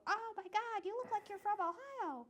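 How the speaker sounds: high, mock-gushing voice; mocking other people. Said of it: "everybody is always talking about".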